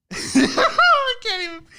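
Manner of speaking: high, loud, slow cracking-up laugh; helpless laughter. Real, not acted. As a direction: lose it completely laughing.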